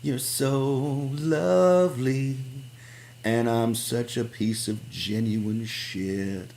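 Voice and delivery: high, slow, gushing mock praise-song voice; mock worship singer. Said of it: "that really gross stuff that's like".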